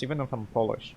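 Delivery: ordinary talk, nothing marked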